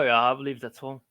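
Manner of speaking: ordinary delivery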